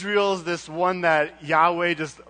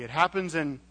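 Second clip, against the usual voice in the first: usual speaking voice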